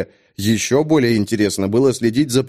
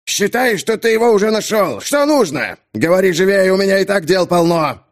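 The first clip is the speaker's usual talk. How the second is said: boil over more with each word; irritated captain, building; 6 semitones higher than usual. Acted. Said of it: "капитан закипал всё сильней". (капитан закипает всё сильней)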